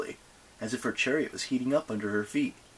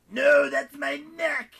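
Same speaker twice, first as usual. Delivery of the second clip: gagging; 7 dB louder, slower than usual. Acted. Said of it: "Garrus gagged".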